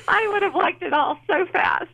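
said through a high laugh; half-laughing admission of impatience. Real, not acted. Laughing about her impatience.

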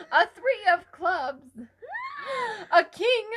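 gasping, building to a loud cry; hammed-up fortune teller reveals cards; dramatic gasps.

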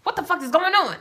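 panicked loud question; shocked outburst. Retold or imitated. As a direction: blurt it out in alarm. Retold shock at email.

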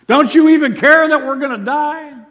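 high, loud plea in panic; alarmed outcry. Acted. Disciples to sleeping Jesus on a sinking boat, panicked outcry. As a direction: cry out over a raging storm.

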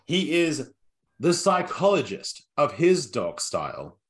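drawn-out, announcer-style host introductions; showman intro. Acted. Podcast host introducing co-hosts like a wrestling ring announcer.

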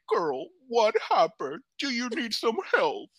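high, drawn-out put-on voice; exaggerated, slow, high-pitched offer of help; concerned drag queen. Fellow performer checking on injured friend, high put-on concern.

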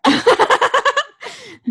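loud high burst of laughter; big laugh. Real, not acted. Laughing at camera joke.

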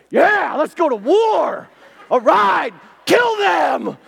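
high, loud stadium battle shout, slow at first; rally the crowd for war; mock war cry. A fan pumped up in the stadium, yelling loud. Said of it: "you're sitting there like".

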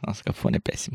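hushed disgusted whisper. Real, not acted. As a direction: whisper it with distaste.

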